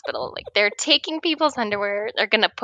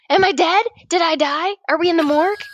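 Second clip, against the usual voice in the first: high-pitched mock panicked calling out; retold yell; 6 semitones higher, faster than usual. Patient waking from surgery to the nurse, mock yelling out. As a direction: reenact a groggy patient's alarmed questions. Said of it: "and immediately yell out".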